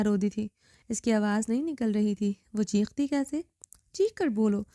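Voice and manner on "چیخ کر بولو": usual speaking voice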